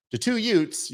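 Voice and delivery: put-on high, hammy Brooklyn accent. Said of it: "terrible, uh, Brooklyn accent I just did".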